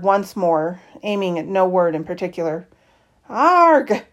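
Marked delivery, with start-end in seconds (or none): 3.3-4.0 high loud frustrated screech. A narrator's reading. Girl who cannot speak screeching in frustration, high and loud. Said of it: "I screeched".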